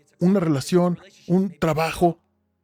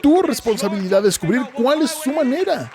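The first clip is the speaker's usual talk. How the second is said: preaching shout; 6 semitones higher than usual. Real, not acted. Preacher exhorting the congregation, a full shout. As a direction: hammer the point home to the crowd.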